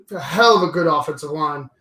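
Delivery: ordinary talk, nothing marked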